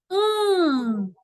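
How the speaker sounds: "An" as one long nasal vowel; drawn-out, humming "An"; nasal vowel sound. Shown as a demonstration. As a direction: hold "An" through the nose, N not sounded separately. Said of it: "faz um dígrafo com A".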